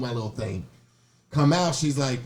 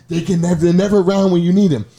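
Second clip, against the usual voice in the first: normal talking voice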